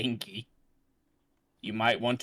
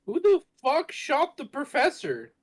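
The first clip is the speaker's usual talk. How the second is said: much higher, loud disbelief; shocked; 4 dB louder than usual. Real, not acted. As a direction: blurt it out in shock. Shocked at game twist.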